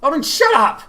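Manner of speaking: an irritated comrade silencing a braggart; angry order. Acted. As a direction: cut him off hard. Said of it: "Ignacio ordered angrily".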